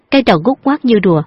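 ordinary delivery, nothing marked

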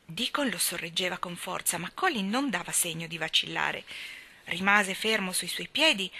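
usual speaking voice

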